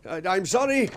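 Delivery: urgent shout of regret; called-out apology. Acted. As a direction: call after someone leaving. Calls apology after departing woman.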